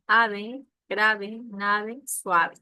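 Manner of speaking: "ave, grave, nave, suave" cada palabra fuerte y separada; rhyme words punched out loud. Shown as a demonstration. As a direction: marca la rima en "-ave" con fuerza. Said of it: "Como ejemplo". (ejemplos de rima dichos con énfasis)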